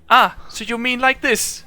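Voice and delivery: high, questioning machine voice; curious robot. Acted. Warforged checking whether its handshake is right, high robotic voice. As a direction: check the custom like an earnest robot. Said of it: "stuck there and like".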